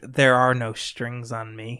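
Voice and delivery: slow, low villain voice; Ultron impression. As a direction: draw it out as an ominous villain's line. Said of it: "When, when he says".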